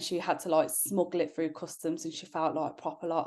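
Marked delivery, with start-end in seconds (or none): none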